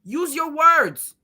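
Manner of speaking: slow, drawn-out scold. Retold or imitated. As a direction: spell it out like to a child.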